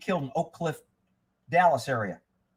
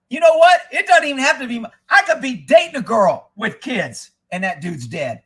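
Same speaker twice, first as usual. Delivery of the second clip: loud heated declaring; heated rant; 4 semitones higher, 7 dB louder than usual. Real, not acted. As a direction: hammer it out loud and defiant. Ranting about killing rapists.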